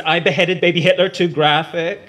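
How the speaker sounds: high, loud blurted question; 1 blurted outburst. Acted. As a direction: burst out with it, half proud half worried.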